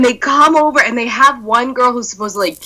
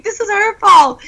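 indignant; 12 semitones higher than usual. Retold or imitated. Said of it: "I was like".

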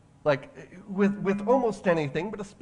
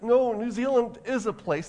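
insistent protest; 6 semitones higher, 5 dB louder than usual. Retold or imitated. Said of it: "And she's like".

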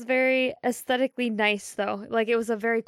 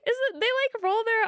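laughing; 11 semitones higher, slower than usual. Real, not acted. Laughing after Scottish attempt.

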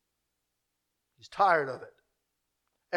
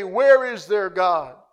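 drawn-out question, much slower; slow mocking question; 2 semitones lower, 5 dB louder, much slower than usual. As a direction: let it hang, taunting. Nations mocking quote.

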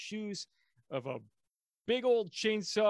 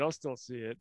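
normal talking voice

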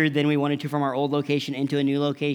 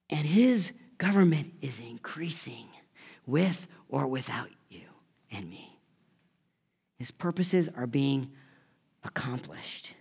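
hushed, slow whisper of conviction; solemn whisper; 5 dB quieter, slower than usual. Acted. Preacher telling the church softly, in a whisper. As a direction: lean in and whisper the truth. Solemn truth told to congregation.

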